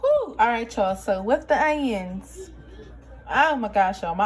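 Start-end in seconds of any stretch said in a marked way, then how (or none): 0.0-0.3 sharp high whew. Real, not acted. Onions stinging her eyes.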